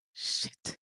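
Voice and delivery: breathy whispered "Shit" under the breath; whispered curse. Real, not acted. Realizing she may be pregnant, whispered curse. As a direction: let it slip out in a whisper.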